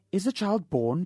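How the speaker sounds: begging. Acted. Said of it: "begged Mister Button".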